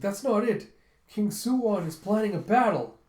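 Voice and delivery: high, loud excited correction; insist, bursting with news; eager insistence. Retold or imitated. Chul telling the general about the battle. Chul urgently reports to Gunte.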